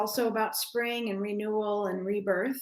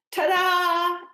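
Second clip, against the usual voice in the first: high, loud showman's flourish; grand ta-da; 7 semitones higher, 6 dB louder than usual. Acted. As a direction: present it like a magic trick. Lion unveiling scene.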